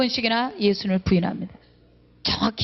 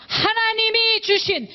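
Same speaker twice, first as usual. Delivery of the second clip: mock Adam excuse; 10 semitones higher, slower than usual. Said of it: "아담은".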